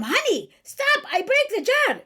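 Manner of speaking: high, loud mock-exasperated wife in Chaldean accent; sharp tone. Mother acting a wife at her husband, sharp tone. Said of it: "when you have the tune in your voice".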